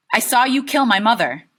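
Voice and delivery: high, loud, firm accusation. Acted. Boy accusing his father to his face, loud.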